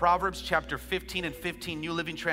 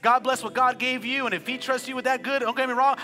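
climbing higher and louder into a shouted praise; preaching at full force; 7 semitones higher, 8 dB louder than usual. Acted. Pastor leading the church in praise, rising to a shout. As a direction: build the prayer from thanks to a full shout.

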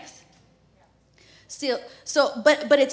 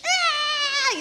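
high sharp ah; a sudden high yelp; startled yelp; 13 semitones higher, 3 dB louder than usual. Retold or imitated. Mom reliving the needle going in, high yelp. Baby gets the shot.

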